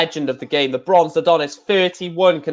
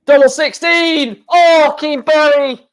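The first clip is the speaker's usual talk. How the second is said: excited shout; 7 semitones higher, 5 dB louder than usual. Real, not acted. Missed double at key moment.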